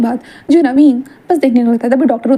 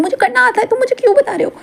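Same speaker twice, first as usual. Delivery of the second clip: high petulant Wing; 12 semitones higher than usual. Acted. Wing snapping during exercise.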